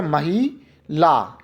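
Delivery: मही, then a clear pause before ला; syllable pauses. Shown as a demonstration. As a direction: draw out मही, ला very slowly in separate beats. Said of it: "ही पे रुके और फिर ला".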